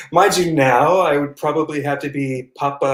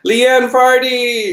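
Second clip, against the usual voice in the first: delighted; 12 semitones higher, 6 dB louder, much slower than usual. Real, not acted. Delightedly naming old castmate in photo.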